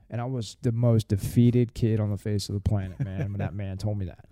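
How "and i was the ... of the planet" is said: usual speaking voice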